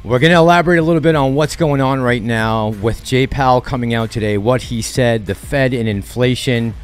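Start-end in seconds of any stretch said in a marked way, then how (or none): none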